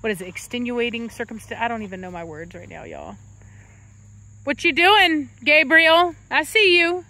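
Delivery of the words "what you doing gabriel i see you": higher-pitched doting greeting; cooing to a pet. Real, not acted. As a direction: coo hello to your dog. Greets her dog affectionately.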